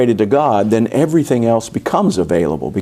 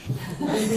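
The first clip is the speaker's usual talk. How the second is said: soft breathy laugh; 10 semitones higher, 13 dB quieter than usual. Real, not acted. Laughs at front row anecdote.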